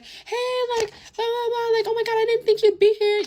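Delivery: very high, loud squeal of fake delight; mocking girly gush. Imitating an art classmate greeting her at a party, mocking high gush. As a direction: parody an over-friendly girl greeting someone. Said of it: "you know how bitches be".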